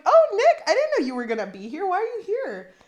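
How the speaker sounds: hammed-up startled mother greeting Nick; mom surprised. Mom voiced in retold scene.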